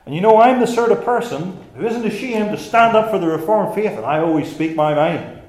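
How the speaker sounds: loud, high, self-congratulating declaration; puffed-up boasting. Acted. Preacher voices a self-proclaimed defender of the faith, puffed up. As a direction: play the swaggering hero of the faith. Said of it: "it sounds like you're getting puffed up".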